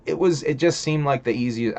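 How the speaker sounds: usual speaking voice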